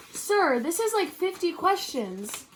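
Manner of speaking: high, loud, slow whine; whiny complaint. Acted. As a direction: groan about the homework. Student complains about homework.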